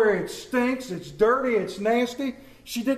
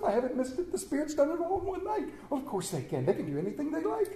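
much softer, quick private delight; to himself; 7 semitones higher, 7 dB quieter, faster than usual. Acted. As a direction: marvel under the breath. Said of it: "Scrooge said to himself".

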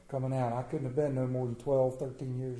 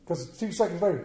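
ordinary talk

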